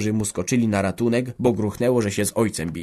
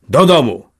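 sharp, louder "Do domu!" falling hard; an old farmer barks at his wife to come home; loud command; 4 semitones higher, 9 dB louder than usual. Acted. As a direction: order her home in a loud voice. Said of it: "powiedział głośno".

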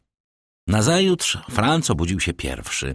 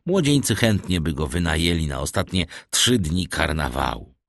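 plain reading voice

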